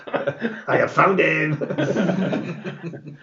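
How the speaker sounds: lightly voiced tinny intercom voice; a hint of a thin, reedy guy over comms. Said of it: "you hear a thin, reedy voice on the intercom".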